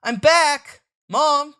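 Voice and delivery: high, loud call for mom. Acted. Child calling home to his mother, loud high call. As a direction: shout for mom from the doorway.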